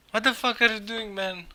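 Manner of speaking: usual speaking voice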